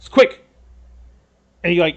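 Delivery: sudden loud bark; urgent command. Acted. Lord urgently orders his people, loud and sudden. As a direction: fire it out as one sharp, loud order.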